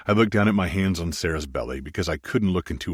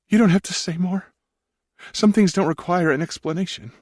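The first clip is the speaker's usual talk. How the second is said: higher, louder woman's voice, tender; Sarah's voice; 13 semitones higher, 4 dB louder than usual. Acted. Wife reassures Maximus he needn't explain, in a higher woman's voice. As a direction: speak as the gentle wife, pitched up.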